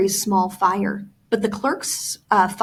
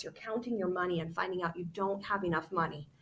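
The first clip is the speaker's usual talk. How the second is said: usual speaking voice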